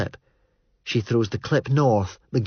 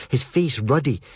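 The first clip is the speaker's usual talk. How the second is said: usual speaking voice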